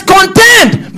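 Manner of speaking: high, loud preacher's exhortation; punched high and loud; urgent command. Retold or imitated. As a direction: cry it out as an urgent charge. Preacher cries out the scripture's command.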